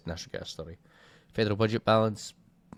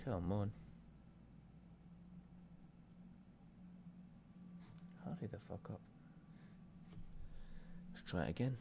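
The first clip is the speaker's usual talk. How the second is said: much softer, impatient grumble at the computer; muttered impatience; 18 dB quieter than usual. Real, not acted. Waiting on a lagging website, muttered impatience.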